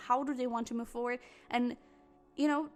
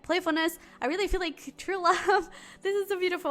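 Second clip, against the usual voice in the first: bright excited gush with a laugh; 6 semitones higher, 6 dB louder than usual. Real, not acted. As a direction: gush about true love, laughing. Gushing over a love reading.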